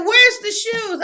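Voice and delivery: high, loud imitated demand; mock exasperation. Mom moving toys out the way, loudly mock exasperated. Mom asks again, retold.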